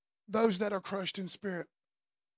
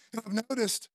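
ordinary delivery

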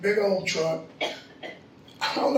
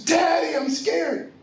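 imitating scared daughter; 6 semitones higher, slower than usual. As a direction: act out a frightened child calling Dad. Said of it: "she's crying".

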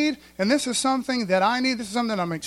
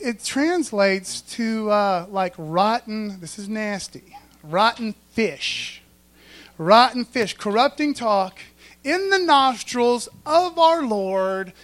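ordinary talk, nothing marked

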